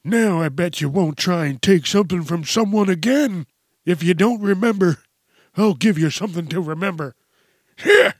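put-on high, loud old man voice, cranky and fired up; a cranky old geezer ready to brawl; feisty old man. Old man fights off mugger.

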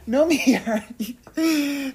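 high laughing squeal at the dog. Real, not acted. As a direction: laugh through the words. Laughing while scolding the dog.

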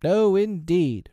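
high, slow loud refusal; twins' reply. A narrator's reading. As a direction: answer firmly like boys. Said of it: "replied the twins".

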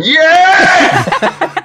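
high, loud drawn-out crowd cheer; cheering yell. Retold or imitated. Fan answering "Scream for me" from the back garden, long cheering yell. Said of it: "like, going, / Scream for me, Donington".

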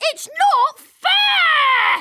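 high, slow bratty wail; bratty whine. Acted. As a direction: whine it like a spoilt kid. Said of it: "Another one could be".

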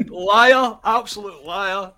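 laughing, loud teasing cry of liar; host teasing his co-host with a laughing, loud, mock accusation. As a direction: call him out in jest, high and loud.

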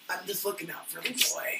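quiet imitation of Snape; hushed, lightly voiced Snape; soft mock Snape.

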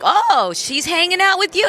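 surprised. Retold or imitated. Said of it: "and I'm like".

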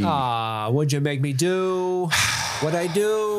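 mock dismay. Quoting Francis after the shooting.

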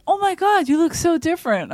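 Stuttering John overdoing his surprise at Wheels; mock surprise. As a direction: gush like a total stranger just recognised. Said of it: "And he goes".

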